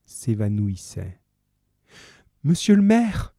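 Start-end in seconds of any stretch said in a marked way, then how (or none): 2.4-3.3 high, loud anguished cry; desperate cry. Acted. Fantine seeing the mayor arrested, crying out high and loud. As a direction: cry out in horror as he is seized. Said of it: "cria Fantine".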